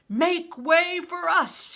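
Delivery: slow, loud, high roar; booming slow command; bellow. A narrator's reading. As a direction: bellow it at the soldiers. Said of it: "he bellowed".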